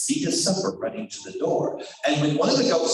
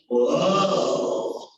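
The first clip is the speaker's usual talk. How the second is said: stumbling whoa. Acted. Wolf stumbles.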